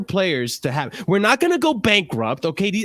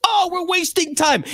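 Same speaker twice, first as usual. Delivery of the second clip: much higher, whiny mock complaint; mock complaining fan; 10 semitones higher than usual. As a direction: parody an impatient whining fan. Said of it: "Chelsea fans are gonna be complaining".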